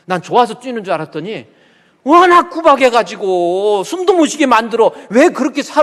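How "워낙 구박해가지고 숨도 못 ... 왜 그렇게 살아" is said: loud, high-pitched rebuke; scolding outburst. Acted. Preacher scolding husbands who bully their wives, loud indignant rebuke. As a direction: tell them off at full voice.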